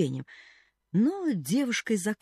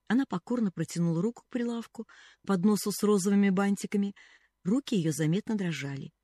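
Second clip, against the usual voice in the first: ordinary delivery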